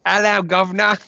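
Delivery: loud, high-pitched mock British greeting; exaggerated British accent. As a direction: belt out a hammy British accent. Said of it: "completely superfluous British accent".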